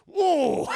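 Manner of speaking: high, loud, drawn-out whoa; mock cry of whoa. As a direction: let out a long high whoa. Acting out Dooku's cut.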